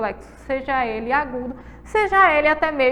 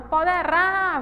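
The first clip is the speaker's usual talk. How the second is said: poderá with a raised, drawn-out final RÁ; stress on last syllable; 5 semitones higher, slower than usual. Shown as a demonstration. Teacher showing an oxytone, poderá with long stressed ending. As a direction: lift and hold the RÁ of poderá. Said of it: "é a sílaba mais forte".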